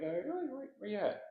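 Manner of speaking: fake-impressed squeak; mock surprise. Philly cop doubting a suburb guy, high sarcastic mock surprise. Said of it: "I'm like".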